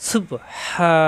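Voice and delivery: হা from the middle of the throat, pressed; throat h demo. Shown as a demonstration. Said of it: "হা কণ্ঠনালীর মাঝখানে চাপ দিয়ে". (কণ্ঠনালীতে চাপ দিয়ে হা)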